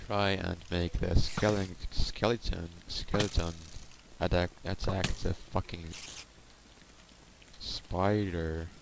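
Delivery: usual speaking voice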